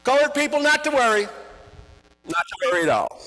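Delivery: high, loud grand country voice; Kriegel. Retold or imitated. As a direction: announce yourself like a showman. Kriegel voiced making his entrance.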